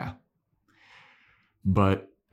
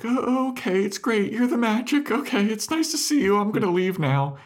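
much higher, nervous placating mock; nervous Dawn voice. Podcaster as Dawn humoring dark Willow, high and nervous. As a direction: squeak it out, edging toward the door.

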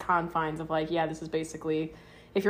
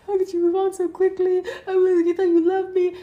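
hurt ex; 13 semitones higher, 9 dB louder than usual. Retold or imitated. Voicing a hurt ex after breakup.

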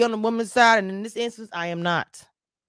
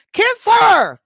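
high, drawn-out crowd yell; screamed cheer; 14 semitones higher, 11 dB louder than usual. Retold or imitated. Wedding guests urging the groom on, screamed cheer. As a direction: imitate a whole church screaming, much higher and louder. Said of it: "the whole church was screaming".

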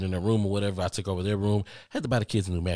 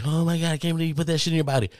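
fast, higher mock outrage; a quick, higher hammed-up gasp of disapproval; mocking critic; 7 semitones higher, 3 dB louder, faster than usual. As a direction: parody the people who judge you. Mocking anti-vax critics.

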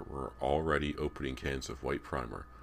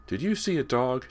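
put-on high, loud boy's question; insistent young voice; demanding; 11 semitones higher, 6 dB louder than usual. A narrator's reading. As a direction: demand an answer, pitched up. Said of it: "James demanded".

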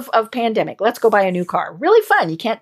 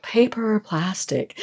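sexy voice; 6 dB quieter than usual. Shown as a demonstration. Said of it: "you gotta say it in a very sexy voice".

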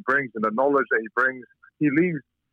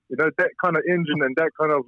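usual speaking voice

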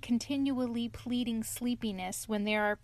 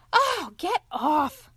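slow, much higher and louder splutter through food; spluttering, mouth full; 9 semitones higher, 7 dB louder, much slower than usual. Acted. Rat spluttering at the hugging otter with mouth full. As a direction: push him off while chewing. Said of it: "spluttered the rat with his mouth full".

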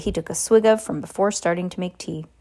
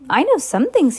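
put-on defensive boy; 8 semitones higher, 5 dB louder than usual. A narrator's reading. Harry defends himself.

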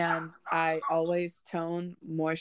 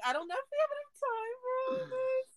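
laughing; 4 dB quieter than usual. Real, not acted. Laughing through mock overwhelm.

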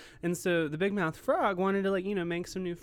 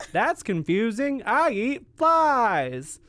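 loud, slow, wide-mouthed frog voice; big mouth frog; 7 semitones higher, 9 dB louder, much slower than usual. Acted. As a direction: boom each word through a huge mouth.

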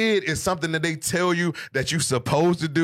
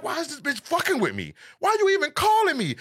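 retold angry outburst; 10 semitones higher than usual. Man retelling his ex's call, indignant high outburst. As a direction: snap back at her, voice shooting up. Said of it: "I'm like".

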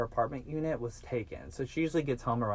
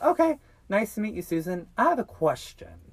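higher, louder chatty kidnapper; mock small talk; 5 semitones higher, 5 dB louder than usual. Kidnapper quizzing his captive in the car, chatty. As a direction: imitate overly friendly getting-to-know-you chat. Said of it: "trying to make all this small talk".

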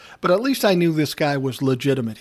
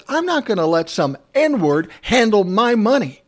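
higher, louder furious retort; 6 semitones higher, 4 dB louder than usual. Retold or imitated. Artist snapping back at the A and R guy, higher and louder. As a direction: bite back hard, insulted.

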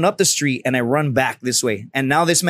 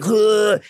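higher-pitched pretend vomit heave; fake retch; 6 semitones higher than usual. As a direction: imitate someone faking throwing up. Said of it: "pretending to vomit".

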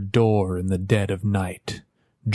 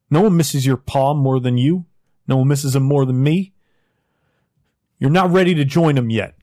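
louder, higher, pushing through grief; loud emotional plea; 4 semitones higher, 5 dB louder, faster than usual. Acted. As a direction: beg him not to give up. West pleads with grieving Jack.

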